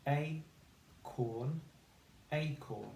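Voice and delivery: "acorn" blended smoothly, rising then falling; blending acorn. Shown as a demonstration. Teacher blending acorn after sounding it out. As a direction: blend the sounds into the whole word. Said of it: "say the sounds to read the word".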